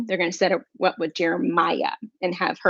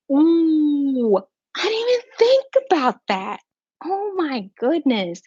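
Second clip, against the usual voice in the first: excited squeal; 8 semitones higher, slower than usual. Real, not acted. Sudden realization about the gift.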